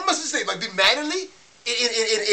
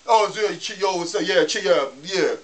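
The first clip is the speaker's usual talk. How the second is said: lower, lazy, distracted mumbling; chit-chatting kid; 5 semitones lower, slower than usual. Customer mocking the McDonald's kid on the phone, lazy chit-chat voice. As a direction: imitate a bored cashier chatting instead of serving. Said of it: "he's chit-chatting like this".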